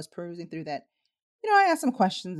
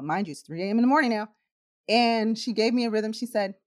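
normal talking voice

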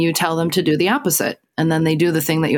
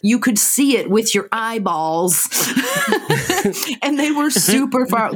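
drawn-out words, hammering the point; slow emphatic insistence; 3 semitones higher, slower than usual. Real, not acted. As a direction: spell it out slowly, exasperated. Emphatic arguing over a plot hole.

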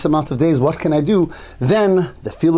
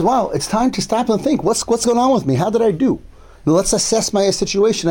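higher-pitched voicing of sudden self-questioning; sudden realization; 6 semitones higher than usual. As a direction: imitate someone stopping to take stock, pitched up. Voicing the sage's sudden self-reckoning.

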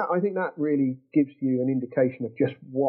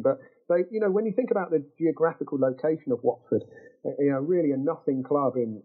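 ordinary talk, nothing marked

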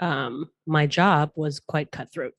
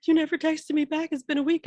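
mock complaining friends; 11 semitones higher than usual. Friends complaining about no reply.

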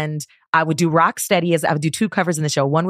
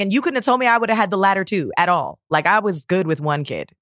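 normal talking voice

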